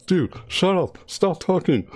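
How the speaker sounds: high-pitched mock "stop talking"; imitated annoyed complaint. Streamer quoting most people tired of the chatter, high mock annoyance. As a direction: mimic an irritated listener, pitched well up. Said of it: "most people say".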